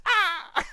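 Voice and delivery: loud, high seagull squawk; seagull cry. Pretend. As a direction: shriek it like a gull. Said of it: "He's gonna be a seagull".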